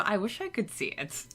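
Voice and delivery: normal talking voice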